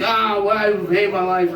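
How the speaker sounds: high mock drunk wail; mock scream. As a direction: wail at the sky in self-pity. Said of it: "just screaming at the top of my lungs".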